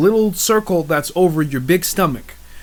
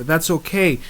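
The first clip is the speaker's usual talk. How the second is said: usual speaking voice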